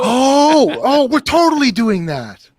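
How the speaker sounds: high, loud drawn-out excitement; excited realization. Real, not acted. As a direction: seize on the idea with a big, rising whoop. Delighted at the T-shirt joke idea.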